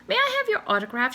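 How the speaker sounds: put-on high, fast young-girl voice; breathless fan girl. A narrator's reading. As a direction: rush it out like an eager girl. Excited girl asks swan.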